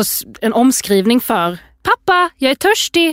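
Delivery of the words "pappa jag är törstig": high mimicked child's call. Parent quoting the storybook line, high calling child voice. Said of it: "exakt som Alfons säger".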